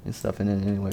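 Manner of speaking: usual speaking voice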